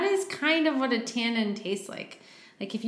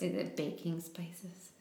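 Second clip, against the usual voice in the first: whispered; 10 dB quieter, slower than usual. Real, not acted. Teasing guess at wine notes.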